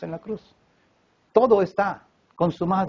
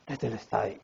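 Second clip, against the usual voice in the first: mock dying gasp, very soft and low; faint last-breath murmur, imitating a weak man; 9 semitones lower, 13 dB quieter than usual. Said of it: "que no tenía más aliento".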